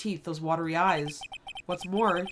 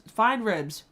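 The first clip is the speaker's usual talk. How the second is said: ordinary talk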